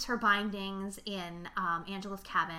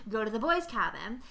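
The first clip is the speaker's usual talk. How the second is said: ordinary talk, nothing marked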